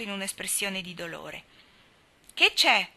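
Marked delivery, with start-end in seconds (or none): 2.3-2.9 higher, louder anxious ask; a worried girl's quick question. A narrator's reading. Girl anxiously asking the hurt boy, worried. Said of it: "chiese Daisy ansiosa".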